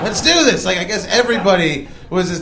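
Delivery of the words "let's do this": high, excited rallying cry; rally the neighbours with glee; eager rallying. Retold or imitated.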